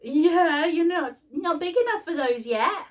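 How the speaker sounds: sing-song cooing at the little one. Real, not acted. Mum turning to coo at her baby son in high baby talk.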